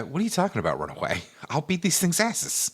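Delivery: lightly voiced cocky hero; brash, dismissive retort with a swinging pitch; Mario. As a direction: brush off the warning with swagger. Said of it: "Mario's got kind of like a".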